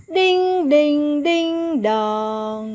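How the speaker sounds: sing-song clock chime sound. Shown as a demonstration. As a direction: chant the ding-dong of a clock. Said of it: "thì các em sẽ hô".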